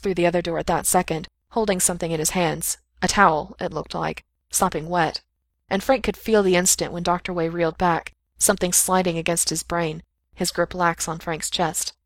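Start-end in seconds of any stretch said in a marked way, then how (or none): none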